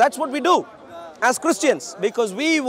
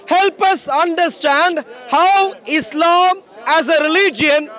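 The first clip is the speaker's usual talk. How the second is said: higher, louder, slow proclamation; street preaching at full force; 6 semitones higher, 3 dB louder than usual. Acted. Preacher proclaiming to a street crowd, loud and slow. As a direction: proclaim it from the rooftops.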